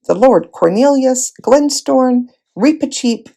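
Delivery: ordinary delivery, nothing marked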